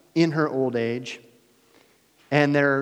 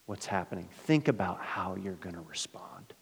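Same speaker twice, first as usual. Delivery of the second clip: whisper; 6 semitones lower, 11 dB quieter than usual. Real, not acted. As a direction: whisper it to draw them in. Preacher urging quiet reflection.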